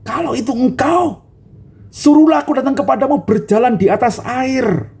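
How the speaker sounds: high, earnest plea of an impulsive man; eager Peter. Acted. Peter calling to Jesus from the boat, eager. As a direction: beg to come out on the water. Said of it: "Petrus itu langsung berkata".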